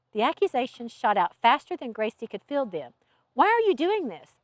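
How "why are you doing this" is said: high-pitched, tense demand; exasperated, teeth clenched; demanding through clenched teeth. A narrator's reading. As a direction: demand an answer, pitch jumping up. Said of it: "Alex demanded through clenched teeth".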